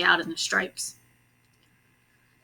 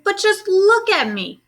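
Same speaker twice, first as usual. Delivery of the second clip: high, louder dismay; a girl begging you to see the problem; upset girl; 10 semitones higher, 5 dB louder than usual. A narrator's reading. Camilla showing her mother the stripes, high and upset.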